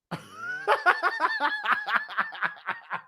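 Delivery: squealing high laugh. Real, not acted. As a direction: burst into a shrill laugh. Laughing at his mother.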